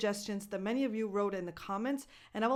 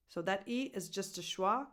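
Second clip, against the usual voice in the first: ordinary talk, nothing marked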